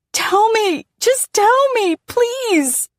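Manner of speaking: very high desperate scream. Acted. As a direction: shriek the plea at him. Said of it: "I scream and yell at my dad".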